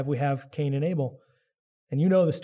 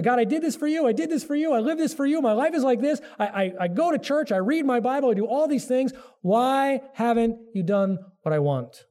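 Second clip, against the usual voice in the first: whiny complaint to God; 7 semitones higher than usual. Retold or imitated. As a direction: sound hard done by, demanding your due. Voicing a believer's complaint to God.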